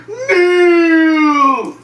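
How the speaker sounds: very long, high, loud howl; photobombing yell. Acted. Zod running into someone's scene, long bellow. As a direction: bellow it as if bursting in. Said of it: "just runs in going".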